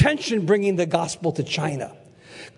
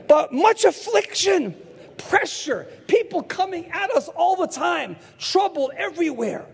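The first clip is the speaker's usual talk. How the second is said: high, emphatic listing of hardships; fervent preaching; 9 semitones higher than usual. Real, not acted. Preacher returning to his theme of affliction, fervent preaching. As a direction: drive each trouble home to the congregation.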